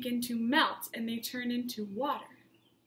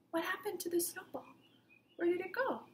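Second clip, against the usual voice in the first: high, quick whisper of wonder; mysterious whisper; 6 semitones higher, 6 dB quieter, faster than usual. A narrator's reading. Teacher whispering a puzzle to her young class. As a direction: hush the class with the question. Asking children about the mystery.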